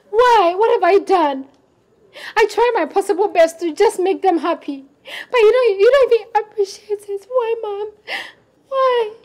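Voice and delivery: tearful, voice rising in pain; crying. Acted. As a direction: plead through tears. Daughter breaks down.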